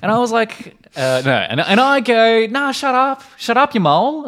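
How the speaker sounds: put-on very high, loud broad Australian teen girl; Kylie Mole impression. Kylie Mole telling her friend to shut up, broad Australian. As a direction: snap back like a bratty Aussie teen. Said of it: "I'm doing a terrible job of it".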